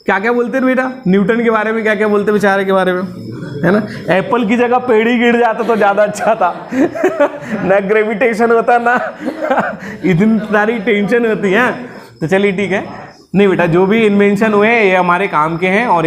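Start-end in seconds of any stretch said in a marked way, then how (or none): 4.1-11.7 words broken up by laughter; laughing while joking. Real, not acted. Teacher laughing at his own joke to the class, laughing. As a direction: chuckle through the joke about Newton. Teacher jokes about Newton's apple.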